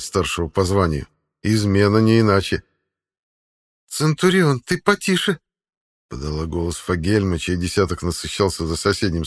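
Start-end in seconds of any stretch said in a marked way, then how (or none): 3.9-5.4 high female voice calling out; woman's voice. A narrator's reading. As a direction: call across as a woman officer.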